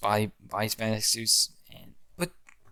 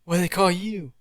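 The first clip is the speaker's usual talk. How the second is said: higher, theatrical hero demand; mock hero challenge; 8 semitones higher, 4 dB louder than usual. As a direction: call out like a cocky superhero.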